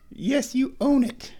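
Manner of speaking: slow, high-pitched cooing. Real, not acted. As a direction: draw it out high for a pet. Baby-talking the cat.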